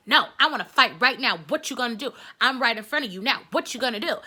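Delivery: loud goading to fight; mock street challenge; taunting challenge. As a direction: push in her face, daring her. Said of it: "She was like".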